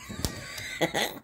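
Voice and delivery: high laugh. Real, not acted. Laughs at the card's joke.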